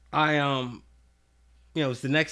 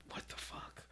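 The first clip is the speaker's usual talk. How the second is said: shocked whisper; 26 dB quieter than usual. Retold or imitated. Stunned reaction to her news.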